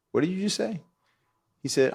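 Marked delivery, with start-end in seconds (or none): 0.1-0.8 high, sharp disbelieving coach; menacing coach. Retold or imitated. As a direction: spin round on him in disbelief. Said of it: "and he said".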